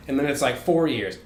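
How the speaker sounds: ordinary talk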